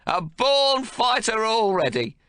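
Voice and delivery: put-on high, loud, bossy old grandfather; gruff domineering old man. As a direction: bark orders at the new mother. Old man voiced in story.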